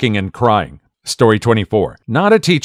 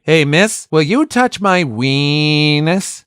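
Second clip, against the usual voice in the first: put-on kid voice; 4 semitones higher, slower than usual. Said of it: "student asks teacher".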